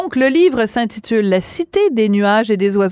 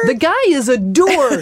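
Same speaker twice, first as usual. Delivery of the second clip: joking English; 4 semitones higher, slower than usual. Pretend. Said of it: "On va prononcer comme ça".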